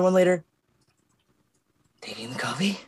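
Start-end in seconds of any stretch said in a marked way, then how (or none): 2.0-2.8 quiet, breathy threat; menacing whisper. Acted. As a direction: hiss it under your breath. Whispered building fury.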